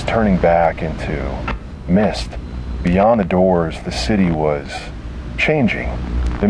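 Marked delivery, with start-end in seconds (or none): none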